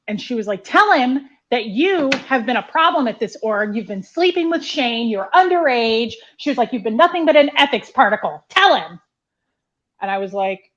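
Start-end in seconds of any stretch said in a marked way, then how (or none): 0.6-6.1 loud retold accusation, pitched up; really angry. Angry woman exposing the girl before her dad, accusing. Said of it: "she was just really angry". 6.7-9.0 loud, higher retold berating; really angry. Angry woman berating the girl before her dad, commanding. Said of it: "she was just really angry".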